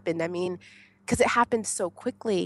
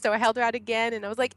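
ordinary talk

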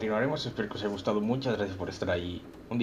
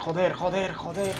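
stressed cursing; 8 semitones higher, slower than usual. Real, not acted. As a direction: swear over and over in rising panic. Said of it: "ahora sí que estoy estresado".

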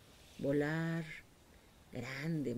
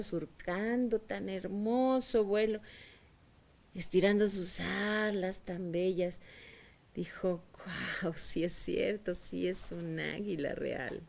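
slow marveling; 3 semitones higher, slower than usual. Acted. Hen marvels at eagle flying.

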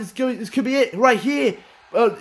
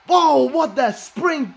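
slow high surprise; 6 semitones higher, much slower than usual. Real, not acted. Surprise at a move.

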